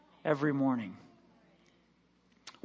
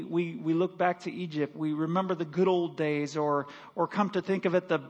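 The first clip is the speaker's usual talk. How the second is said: usual speaking voice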